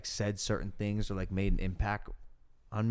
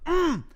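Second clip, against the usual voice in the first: squeaky mock hum; 6 dB louder than usual. Retold reaction, very high squeak.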